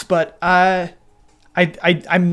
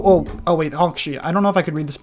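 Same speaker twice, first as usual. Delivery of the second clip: normal talking voice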